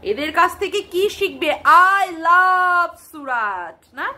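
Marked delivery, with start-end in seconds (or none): 2.0-3.8 "I love সুরাট" stretched very slowly; exaggerated imitation of a showy "I love সুরাট"; mocked affected pronunciation. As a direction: draw out every vowel of "I love সুরাট" in mockery. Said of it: "কি কায়দাবাজি করে আবার উচ্চারণ".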